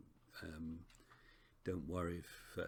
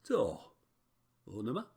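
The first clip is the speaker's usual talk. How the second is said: "To onoma" higher, tones overdone; sing-song "To onoma", pitched up on the accents; exaggerated pitch accent; 4 semitones higher, 6 dB louder than usual. Shown as a demonstration. Teacher guessing at ancient Greek tones, exaggerated pitch accent. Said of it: "doesn't represent anything like the real pronunciation".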